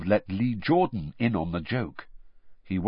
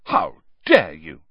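mock-offended loud protest; mock outrage; 5 semitones lower, 6 dB louder than usual. A narrator's reading. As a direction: play at being insulted. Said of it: "said Ron in mock outrage".

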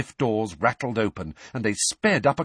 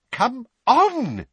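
impatient shout; 5 dB louder than usual. A narrator's reading. Impatient at lift.

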